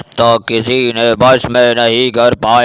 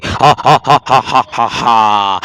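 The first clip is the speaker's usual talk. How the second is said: witch cackle. Acted.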